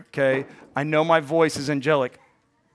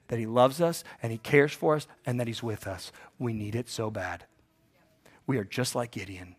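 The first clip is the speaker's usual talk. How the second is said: ordinary delivery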